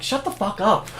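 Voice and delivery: mock annoyed crowd. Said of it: "everyone's just like".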